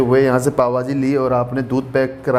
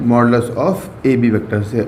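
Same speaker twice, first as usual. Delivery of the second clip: slow emphatic answer; 4 semitones lower, much slower than usual. Shown as a demonstration. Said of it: "किससे represent करते हैं?".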